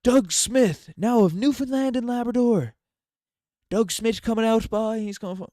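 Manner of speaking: high, loud put-on voice, stretched over the top; hammed-up announcer call. Streamer jokingly announcing Doug Smith of Newfoundland and Labrador, hammed-up high call.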